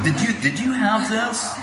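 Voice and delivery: a devotee urging others to taste the feast, excited; excited foodie. Retold or imitated. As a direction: rave about the food to a friend. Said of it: "You know, like".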